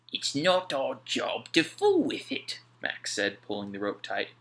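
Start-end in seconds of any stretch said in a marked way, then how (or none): 0.1-2.6 higher, slow dockhand voice; a sailor refusing to meddle; Mac, gruff sailor. A narrator's reading. Mac to Alf at the warehouse, slow sailor voice.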